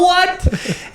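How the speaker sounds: loud, very high yelp through laughter; retold shocked disbelief. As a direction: re-enact the shock, cracking up. Retelling his shock at the news.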